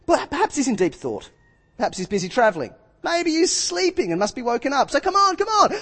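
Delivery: mock teasing, rising high at the end; taunting. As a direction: needle them, mocking their silent god. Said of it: "Elijah began to taunt them".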